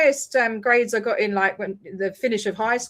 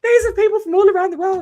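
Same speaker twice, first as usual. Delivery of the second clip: higher, a bit louder, mock indignant; retold heated protest; 8 semitones higher, 6 dB louder than usual. Retelling confronting the man with the list, heated. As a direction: press the point like rolling out the list. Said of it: "And I was like".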